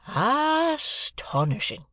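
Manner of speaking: very drawn-out, high first syllable; dazed amazement. A narrator's reading. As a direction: stretch it out in stunned wonder. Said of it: "he prolonged his remark".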